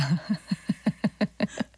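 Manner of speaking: long bubbling laugh; real laughter. Real, not acted. DJ laughing at the sock photo, helpless chuckling. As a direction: crack up in a rapid string of chuckles.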